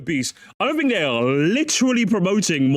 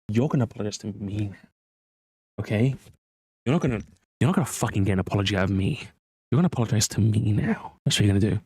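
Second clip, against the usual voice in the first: mock smug streamer; 8 semitones lower than usual. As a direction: drop low and lord it over them. Mocking Alex's apology stream.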